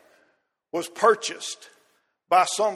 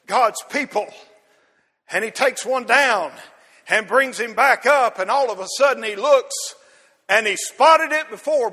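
louder, higher preaching drive; preaching at full force; 3 semitones higher, 6 dB louder than usual. Acted. Preacher telling the church how John spots the lamb, rising hard. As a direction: build the sermon toward its peak.

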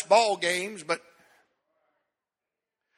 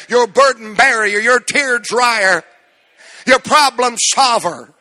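loud, driving preacher's build; preaching at full force; 5 dB louder than usual. Acted. Preacher to congregation, building to a loud climax. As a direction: pile up the titles, louder and louder.

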